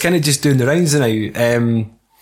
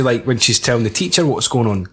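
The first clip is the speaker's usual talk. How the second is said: usual speaking voice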